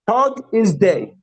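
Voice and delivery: slow, drawn-out teaching line; slow classroom model; slow modelling. Shown as a demonstration. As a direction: spell it out slowly for the class. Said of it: "teacher slow model".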